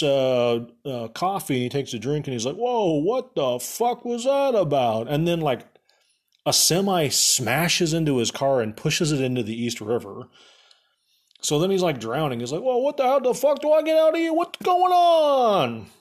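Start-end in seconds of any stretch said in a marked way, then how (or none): 2.6-5.1 high, drawn-out comic disbelief; exaggerated, slow cry of confusion; mock bewildered hero. Hero reacts, sipping coffee. 12.6-15.9 high, loud hammed-up panic; comic frantic yell, last words stretched long; mock panicked yelling. Drowning hero panics in car.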